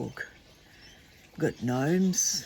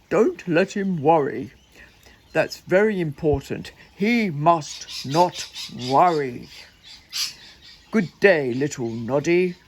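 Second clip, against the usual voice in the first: loud, emphatic doctor's orders; stern doctor bear; 5 dB louder than usual. Acted. Doctor bear instructing Noddy about his patient, stern emphatic voice. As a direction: lay down the order firmly, word by word.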